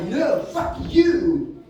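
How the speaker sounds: drawn-out, high, loud retort; slow indignant yell; angry comeback. Retold or imitated. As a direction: fire it right back, stretching it out. Woman yells back at waiter.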